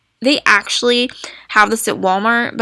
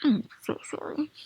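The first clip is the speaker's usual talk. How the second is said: whispered apology; whispering; 4 semitones higher, 13 dB quieter than usual. Real, not acted. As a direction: breathe it out unvoiced. Apologizing quietly during hiccups.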